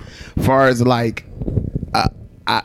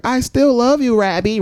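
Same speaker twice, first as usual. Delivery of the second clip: cartoon bird voice; 8 semitones higher than usual. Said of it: "So the rabbit's like".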